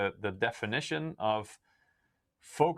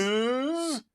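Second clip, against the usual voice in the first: mock announcer; 14 semitones higher, 3 dB louder than usual. Joins the announcer bit.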